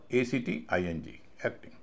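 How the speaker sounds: normal talking voice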